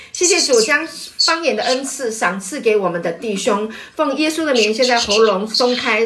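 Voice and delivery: loud, high fervent call to prayer; preaching at full force. Acted. Pastor urging the brothers to pray aloud, loud and fervent. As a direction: rouse the brothers to cry out to God.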